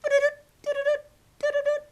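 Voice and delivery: high, rapid doo-doo pulses; loud high-pitched triplets; imitated baby heartbeat. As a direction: mimic a fast fetal heartbeat, loudly. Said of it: "the little baby's heartbeat".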